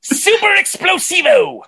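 explosive high, drawn-out rock cry. Acted. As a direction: burst out high and stretch it. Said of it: "he's a bit of an explosion".

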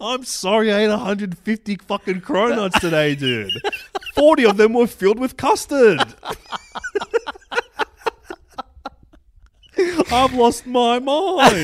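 high, loud, laughing maniac voice; unhinged cronut addict. Acted. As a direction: play a man who's lost his mind. Playing crazed cronut-eating character.

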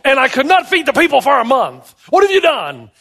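high, loud protest; exasperated Moses. Acted. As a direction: protest in disbelief, climbing to the final question. Acting Moses protesting to God.

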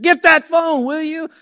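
high, loud urgent yell; loud frantic demand to grab the phone; urgent shout. Retold or imitated. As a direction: bark the order across the house. Household scrambling for ringing phone.

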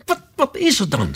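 high, stammered urgent question; alarmed question. A narrator's reading. Alice tries to be heard over shrieks, alarmed. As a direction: ask it urgently, pitched right up.

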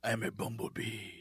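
put-on very low, hushed accented seduction; Antonio Banderas. Host demonstrating his bumblebee voice, Antonio Banderas impression. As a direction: murmur slowly in a deep Spanish-style accent. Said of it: "like a little Antonio Banderas".

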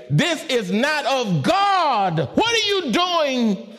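pressing. Acted. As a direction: rise up in urgent challenge. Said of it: "And God's saying".

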